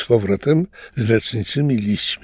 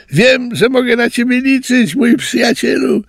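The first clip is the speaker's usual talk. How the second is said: very high, loud little-tapir goodbye; farewell shout; 14 semitones higher, 4 dB louder than usual. A narrator's reading. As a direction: call back happily while leaving. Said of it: "krzyknął na do widzenia tapirek". (radosny okrzyk na pożegnanie, bardzo wysoko)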